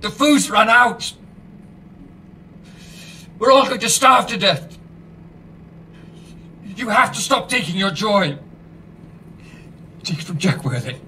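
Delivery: high, urgent, pleading; desperate warning. Acted. Host urgently warns listeners they will starve. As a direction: alarm rising in the voice.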